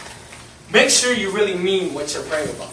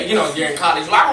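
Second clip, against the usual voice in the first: normal talking voice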